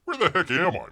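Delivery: ordinary talk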